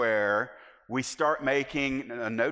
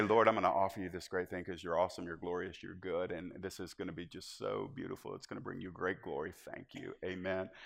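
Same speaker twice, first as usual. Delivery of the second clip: much lower and much softer, quick flowery prayer; pious-sounding prayer; 10 semitones lower, 11 dB quieter than usual. Shown as a demonstration. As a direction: murmur the sweet words fast, fading away. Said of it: "We say".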